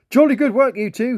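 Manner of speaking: high, loud, jolly lobster praise; smiling praise. A narrator's reading. Lobster praising the two planes, jolly and loud. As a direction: beam at them like a proud old chap. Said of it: "he smiled".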